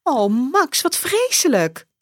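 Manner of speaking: high, sympathetic woman's exclamation; mother's dismay. Acted. Mother hearing Max missed the bus, high and pitying. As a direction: sigh it out in high motherly pity. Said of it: "roept zijn moeder".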